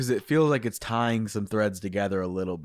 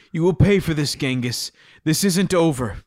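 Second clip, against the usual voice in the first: louder, higher vow of revenge; sputtering foiled villain; 5 semitones higher, 5 dB louder than usual. Acted. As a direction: spit out the threat angrily. Hero threatens the villain.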